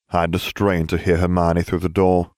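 ordinary delivery

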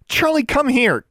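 high-pitched calling shout; urgent shout. A narrator's reading. Girl calling off her attacking raven, shouting. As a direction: call the bird back urgently. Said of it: "Alexandra shouted".

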